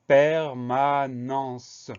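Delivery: very slow "permanence", each syllable stretched out; syllable-by-syllable dictation. Shown as a demonstration. Teacher introducing a long word with many syllables, very slowly.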